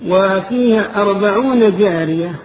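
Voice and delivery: high, loud preacher's narration; full-force sermon storytelling. A narrator's reading. Preacher recounts the gardens of the dream, loud impassioned storytelling. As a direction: project each phrase of the tale with rising fervour.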